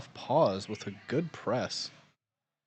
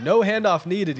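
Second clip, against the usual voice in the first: excited; 7 semitones higher, 9 dB louder than usual. Real, not acted. Strong lifter impresses commentator.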